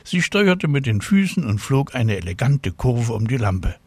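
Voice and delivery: ordinary delivery, nothing marked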